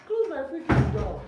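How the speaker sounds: very loud, high-pitched order; irritated yell. Real, not acted. Streamer ordering someone out of the room, irritated yell.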